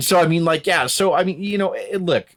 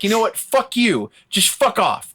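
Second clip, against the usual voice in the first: acted fed-up anger; 8 semitones higher, slower than usual. Acted snapping at a bully.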